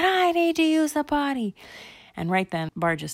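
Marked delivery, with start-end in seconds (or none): 0.0-1.5 put-on very high kid voice; high-pitched copycat plea; urgent little girl. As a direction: pipe up urgently in a much higher girl's voice. Candy urgently needs toilet too.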